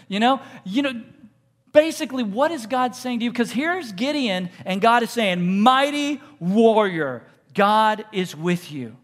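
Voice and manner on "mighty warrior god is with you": very slow, loud, drawn-out herald; booming angelic announcement; grand proclamation. Acted. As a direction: lay each word down heavily. God speaking to Gideon.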